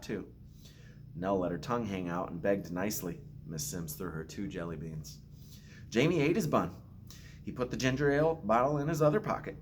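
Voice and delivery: put-on gentle storytelling read to children; story narration. Principal reading a picture book to students, gentle storytelling.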